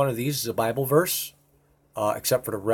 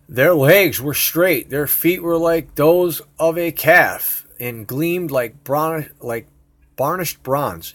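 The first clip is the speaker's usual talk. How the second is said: slow, louder solemn Bible reading; scripture reading; 5 semitones higher, 7 dB louder, slower than usual. Acted. Showing viewers Ezekiel, he reads the verse slowly and solemnly. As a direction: read it like a preacher at the pulpit.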